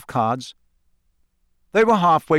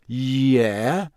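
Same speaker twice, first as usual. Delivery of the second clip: slow, drawn-out hesitant word; slow agreement. A narrator's reading. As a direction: stretch it out, rising at the end. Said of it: "said Harry and Ron slowly".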